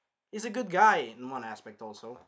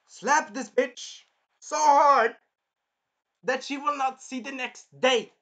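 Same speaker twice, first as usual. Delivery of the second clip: much higher, loud, slow seething; furious wish; 9 semitones higher, 8 dB louder, slower than usual. Real, not acted. Viewer fuming at the princess, loud slow outburst. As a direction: spit out the wish.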